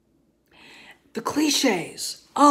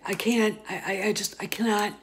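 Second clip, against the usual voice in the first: usual speaking voice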